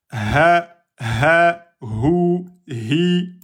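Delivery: Ha, ha, hou, hi breathed from the vocal cords; Arabic throat ha. Shown as a demonstration. Teacher showing students the Arabic ha from the throat. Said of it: "Ça vient donc des cordes vocales".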